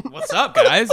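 high, loud fake-innocent greeting; mock casual greeting. Son greeting his parents after being caught, mock casual. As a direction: chirp it up high, playing it cool.

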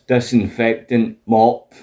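usual speaking voice

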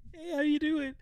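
high-pitched smarmy greeting; a hammed-up high flirt voice; mock Jean Ralphio. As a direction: play the sleazy flirt, way up high. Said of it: "when he sees Anne and Chris".

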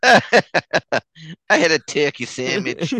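slow mock Boston line through laughter; laughing. Co-host joins the accent joke, laughing. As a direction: crack up while faking a Boston accent.

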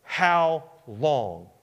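"How long" drawn out, each word apart; pounding frustration. Acted. David pounding the table at God, frustrated "How long". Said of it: "hear him pounding the table".

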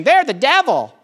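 mock accusation. Believer retelling old accusations against other Christians, mock accusing. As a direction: sing out a mock denunciation.

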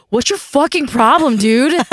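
fed up, bite back at the pest; exasperated outburst. Retold or imitated. Retelling snapping at a coworker, exasperated and loud. Annoyed at friend's cereal request.

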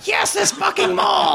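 put-on very high cracked old-lady shout; old lady shriek. Old lady running by, agreeing in a high shriek. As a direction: screech it like a frantic old woman. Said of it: "this old lady runs by".

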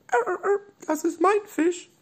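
tiny, very high squeak; squeaky dolphin voice. Acted. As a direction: squeak like a small toy dolphin. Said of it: "Delfin machte". (hohe, piepsige Delfinstimme)

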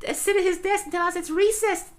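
put-on very high childlike squeak; Ralph impression. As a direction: squeak it out like a dopey little boy. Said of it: "great line from Ralph just goes".

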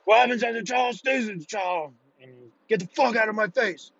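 high, strained instructor voice; drill instructor. Retold or imitated. Drill instructor dismisses recruits after a fight, high strained bark. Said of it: "and he says".

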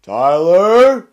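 loud, high, stern call of a name; stern dad calling my name. Retold or imitated. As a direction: bark the name like a dad who caught you. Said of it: "He says your name sternly".

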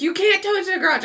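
high, loud, fast panicked protest; retold freak-out. Driver told her car will be towed, freaking out. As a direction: blurt it out in mock panic. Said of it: "I start freaking out again".

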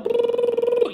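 very high trilled "brrr"; Vespa engine noise. Retold or imitated. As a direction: mimic a little scooter speeding away. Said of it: "that's the sound Vespas make".

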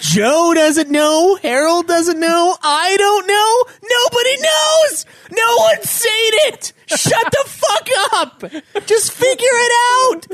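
slow, rising to a high loud yell; building exasperated shout. Pretend. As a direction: build from weary to a full yell. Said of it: "I'm like".

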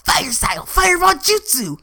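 high shouted anime attack call; anime jutsu call. Retold or imitated. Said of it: "and just go".

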